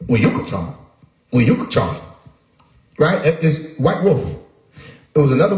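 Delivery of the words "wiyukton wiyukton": Wiyukton with "yuk" hit hardest; stress on yuk. Shown as a demonstration. Teaching the Native word, stress on yuk. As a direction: lean on the middle syllable of Wiyukton. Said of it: "The emphasis on yuk".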